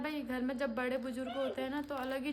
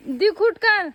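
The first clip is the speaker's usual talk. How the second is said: loud, higher-pitched order; shouted instruction; 6 semitones higher, 15 dB louder, slower than usual. Real, not acted. Woman directs passenger how to sit, loud calling. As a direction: call it out firmly over others.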